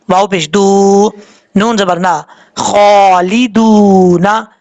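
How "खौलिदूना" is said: slow, drawn-out recitation with a full heavy ख; slow Quran recitation. Shown as a demonstration. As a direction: stretch खौलिदूना like a reciter. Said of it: "खौ हरूफ मुस्तालिफ में से आप पुर पढ़ा जाएगा". (धीमी तिलावत, भारी ख के साथ)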